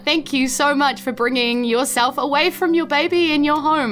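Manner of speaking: ordinary talk, nothing marked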